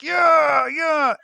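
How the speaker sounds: two high, drawn-out cheers; imitated cheering. Acting out the group cheering as Stumpy swallows it, mock cheer. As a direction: hold each one high, mock celebration. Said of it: "and they're like".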